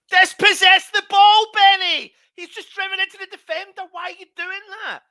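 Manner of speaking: high, loud exasperated shout at the player; furious fan shouting at the screen; frustrated yelling. Real, not acted. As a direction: yell at Benny in disbelief. Angry at player losing ball.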